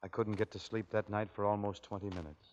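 a spooky bedtime hush; hushed spooky telling. Acted. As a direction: hush it like a ghost story. Eerie tale of a night visit.

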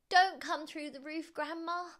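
put-on high-pitched boy's plea; anxious praying. A narrator's reading. George dreads his father's anger, anxious high plea. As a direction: beg it in a high voice. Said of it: "he prayed".

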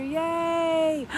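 high cheer then delighted gasp; squeal, then gasp for joy; high giddy cheer; high yay and gasp. Pretend. Excited affirmation chant.

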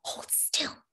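soft, urgent whisper; hushed, whispered instruction; whispered command. A narrator's reading. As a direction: order her under your breath. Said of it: "Anne-Marie commanded".

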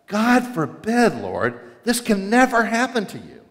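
Peter grabbing the collar, dragging out God forbid; upset protest. Retold or imitated. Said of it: "He is upset".

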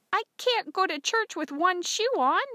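put-on high, loud girlish protest; pleading daughter. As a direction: protest to father at high pitch.

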